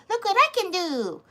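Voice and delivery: squeaky high mock voice. Voicing the dog showing off, squeaky high. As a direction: pipe it up high like a proud pet. Mimics dog's thought.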